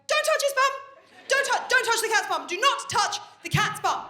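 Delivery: high, loud panicked telling-off; frantic parent warning. Retold or imitated. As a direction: shout it in comic desperation. Frantic parent warning toddler off cat.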